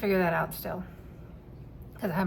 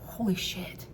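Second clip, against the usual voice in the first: awed hush; 5 dB quieter, slower than usual. Retold or imitated. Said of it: "that feeling of".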